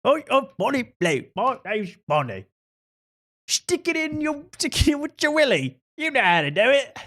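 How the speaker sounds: squeaky high posh British caricature; overdone posh English lady voice; mock posh British. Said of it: "a pretty posh accent".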